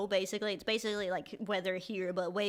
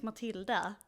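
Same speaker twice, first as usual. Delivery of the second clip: Swedish pronunciation. Shown as a demonstration. Guest showing host her name, Swedish pronunciation. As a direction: say Matilda the Swedish way. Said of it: "say it in the Swedish way".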